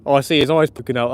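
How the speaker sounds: ordinary talk, nothing marked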